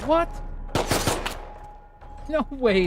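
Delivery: high, loud disbelief; can't believe what you just read; surprised. Real, not acted. Disbelief at the answer meatloaf.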